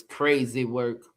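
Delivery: low, slow daddy voice. Acted. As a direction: lightly put on dad's low, weary-paced verdict. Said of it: "In my daddy voice".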